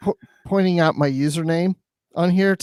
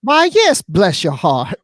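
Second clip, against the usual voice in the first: mock-sweet Southern accent; 2 semitones higher, 5 dB louder, slower than usual. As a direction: gush it in a playful Southern drawl. Playing up Georgia accent in reply.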